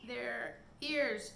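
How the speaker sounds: usual speaking voice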